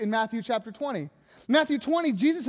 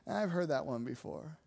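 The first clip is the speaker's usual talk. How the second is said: low, quiet, unimpressed mutter; jaded dismissal; 10 semitones lower, 9 dB quieter than usual. Acted. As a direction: drop it low and soft, already bored. Acting out jaded Bible reader.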